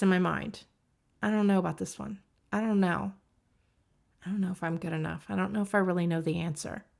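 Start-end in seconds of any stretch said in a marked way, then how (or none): 1.2-6.9 quicker, quieter mutterings of self-doubt; acted self-doubt. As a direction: doubt yourself under your breath. Voicing self-doubting inner thoughts.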